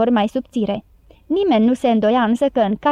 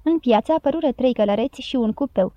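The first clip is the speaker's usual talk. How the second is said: plain reading voice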